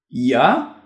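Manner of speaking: mildly startled single word; a touch of feigned surprise; accused man asking innocently; surprised. A narrator's reading. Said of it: "Ashur powiedział zaskoczony".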